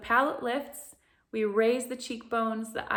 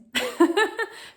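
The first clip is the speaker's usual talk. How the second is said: bright laugh; 11 semitones higher, 7 dB louder than usual. Real, not acted. Laughs mid sentence.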